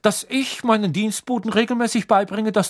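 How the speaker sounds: high, icy, indignant question; outraged, controlled challenge; cold fury. A narrator's reading. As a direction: throw the accusation back with cold rage. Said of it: "mit kalter Wut in jeder Silbe".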